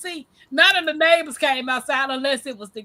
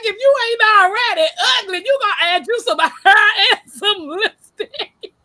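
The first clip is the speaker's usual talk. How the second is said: high, loud, fast laughing jab; laughing mock; 8 semitones higher, 7 dB louder than usual. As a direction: tease it through laughter. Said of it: "I was like".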